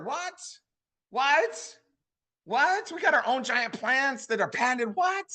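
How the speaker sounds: high, incredulous squeaky "What?"; hyped salesman gasping at the perks; mock amazed whats. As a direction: pop each "What?" way up in hammed-up disbelief. Hyped surprise at company perks.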